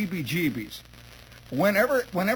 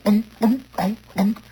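short, loud comic unks; imitated grunts; 4 semitones higher, 6 dB louder than usual. Boy pops his stomach at the visitor, comic grunts. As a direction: punch out silly stomach-popping grunts.

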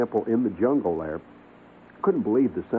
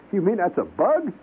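amazed; 11 semitones higher, 3 dB louder than usual. Retold or imitated. Retold amazed question to guide.

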